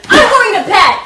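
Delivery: angry, loud storm-off; angry shout. Acted. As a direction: snap it and storm off. Storming off angrily.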